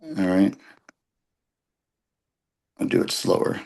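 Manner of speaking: low, barely voiced mutter to himself; muttered aside. Real, not acted. Teacher fumbling with his phone mutters to himself. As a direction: mumble under your breath while fumbling the phone.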